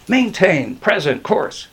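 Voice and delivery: pilot's measured command; giving an order. A narrator's reading. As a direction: order it slowly and deliberately.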